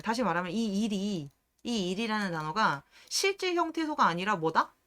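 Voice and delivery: ordinary delivery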